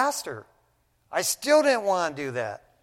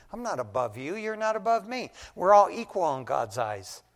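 normal talking voice

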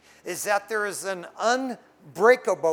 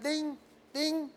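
mock alarm ding; 8 semitones higher, 4 dB quieter than usual. Imitated alarm sound.

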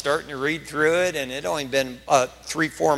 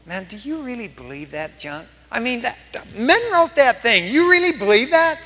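mock disbelief; 8 semitones higher, 6 dB louder than usual. Pastor baiting a Bible reader at Starbucks, mock disbelief building. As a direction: needle him with put-on incredulity, rising to a shout.

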